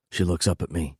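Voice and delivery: ordinary delivery, nothing marked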